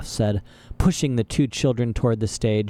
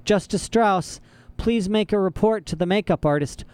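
higher, called from offstage; an offstage voice calling for makeup; 8 semitones higher than usual. A narrator's reading. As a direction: summon her across the room. A voice calls from offstage.

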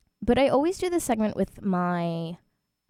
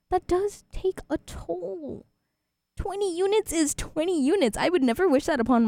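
high mock dramatic; 8 semitones higher than usual. Real, not acted. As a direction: stretch it out like a wail. Complaining about twenty units.

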